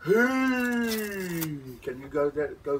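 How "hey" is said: group response shout. Acted. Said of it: "all of the birds and insects go".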